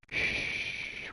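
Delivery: long, soft shush; hushing shh. Real, not acted. Streamer keeping a secret from viewers, hushing. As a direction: hush them with a drawn-out shh. Hushes viewers about the race.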